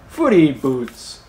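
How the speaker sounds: slow, playful naming; playful nickname. Pretend. Silly nickname for the talons.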